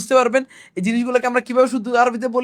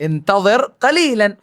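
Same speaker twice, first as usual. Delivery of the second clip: slow demo; much slower than usual. Shown as a demonstration. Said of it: "এটাকে আমরা কিভাবে বলবো?".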